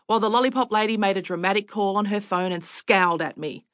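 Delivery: ordinary delivery, nothing marked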